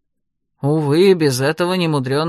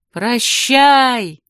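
higher, projected goodbye; called-out farewell; 6 semitones higher, 4 dB louder than usual. A narrator's reading. Heroine waves to the wizard as she leaves, calling out. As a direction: call it out across the water.

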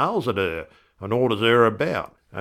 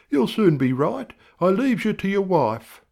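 higher doctor voice; a cheerful doctor, higher; 11 semitones higher than usual. A narrator's reading. Doctor leaving the patient to his wife, higher.

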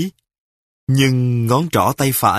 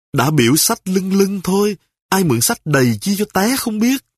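scolding wife; 7 semitones higher than usual. Acted. Wife scolds husband after fall.

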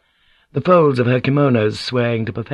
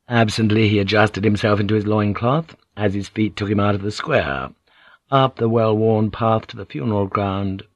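ordinary delivery

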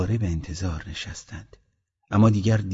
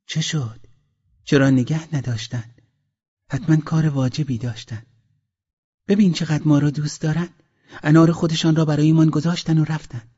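high-pitched eager peasant woman; high, animated wife's voice; curious, hopeful wife; 8 semitones higher, 4 dB louder than usual. Acted. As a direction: ask your husband eagerly, pitched well up.

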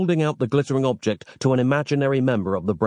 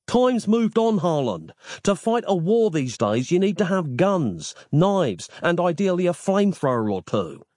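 higher, insistent modern voice; exasperated Nick; 8 semitones higher than usual. A narrator's reading. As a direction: lecture the old monk, fed up. Nick argues with Harland.

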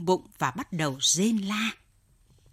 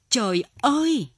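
agonized cry; 6 semitones higher, 4 dB louder than usual. A narrator's reading. Old man writhing in withdrawal pain, agonized cry. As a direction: cry out to heaven in agony. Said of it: "lão Xuyệt kêu gào".

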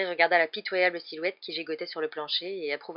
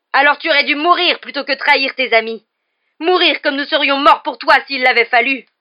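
high, very loud outrage; furious shouting; 8 semitones higher, 16 dB louder than usual. Acted. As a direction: roar condemnation at a traitor. (hurlement indigné)

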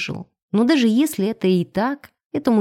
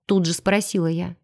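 ordinary delivery, nothing marked

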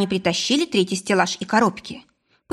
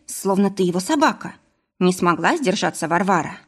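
usual speaking voice

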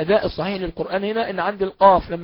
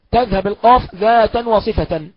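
normal talking voice

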